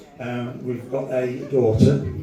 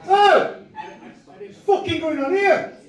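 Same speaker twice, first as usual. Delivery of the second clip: high-pitched comic call-out; retold high shout. Student challenging two brick throwers, high mock shout.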